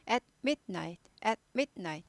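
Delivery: At midnight in three pieces: at, mit, night; carefully broken at midnight. Shown as a demonstration. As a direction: make both stops in each At midnight. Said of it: "부지런히 잘 끊어야지".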